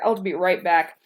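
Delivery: ordinary delivery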